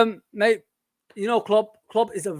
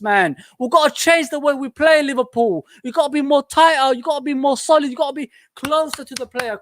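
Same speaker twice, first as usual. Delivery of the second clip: loud, higher, pleading at the team; louder and higher, demanding from the stands; urgent fan shout; 5 semitones higher, 5 dB louder than usual. Real, not acted. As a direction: hammer the words like a desperate supporter.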